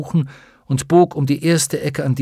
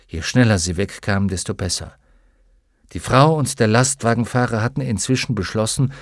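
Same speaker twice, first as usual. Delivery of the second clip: usual speaking voice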